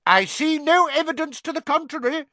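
match her anger; shouting. A narrator's reading. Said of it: "shouted Fudge".

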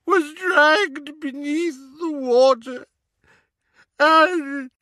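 high, slow elf voice racked with crying; grieving servant elf telling of his master's death; sobbing house-elf. Acted. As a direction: choke the words out between sobs. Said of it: "Kreacher's sobs came in great rasps now".

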